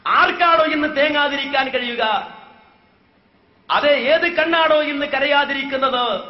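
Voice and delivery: rising, tearful sermon cadence; impassioned preaching. Acted. Preacher retelling the Prophet's death to the congregation, impassioned. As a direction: preach the deathbed scene with mounting feeling. Rhetorical lament questions, Arabic verse.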